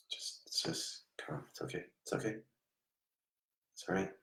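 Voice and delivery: hushed, breathy reassurance to a frightened soul; near-whispered comfort; soothing whisper. Real, not acted. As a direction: whisper it gently, calming him down. Medium calming a hysterical spirit.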